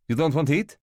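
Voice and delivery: a lightly done Russian waiter, puzzled; Russian accent. Acted. New waiter questioning the departing guests, in a light Russian accent. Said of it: "a Russian accent".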